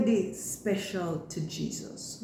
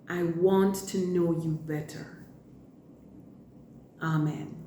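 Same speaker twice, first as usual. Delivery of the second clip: put-on children's prayer; 3 semitones lower than usual. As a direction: pray gently for the kids.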